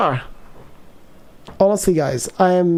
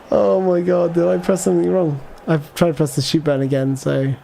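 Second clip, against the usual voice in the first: ordinary talk, nothing marked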